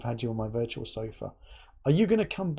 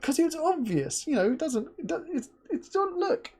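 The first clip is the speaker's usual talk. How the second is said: imitating flustered colleague; 12 semitones higher than usual. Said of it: "He's like".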